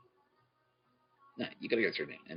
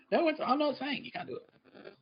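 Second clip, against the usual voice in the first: high defensive protest; 6 dB quieter than usual. Real, not acted. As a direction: rise way up and slow, half-laughing.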